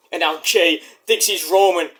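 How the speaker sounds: normal talking voice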